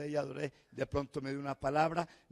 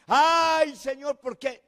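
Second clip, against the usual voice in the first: high, whining "ay, Señor"; mock lament to God, high and whiny; mock complaining whine; 10 semitones higher, 8 dB louder, slower than usual. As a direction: mimic a self-pitying churchgoer. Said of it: "con quejas y lamentos".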